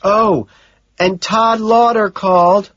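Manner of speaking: a secretary passing on messages; Gene's voice. Acted. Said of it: "Gene says".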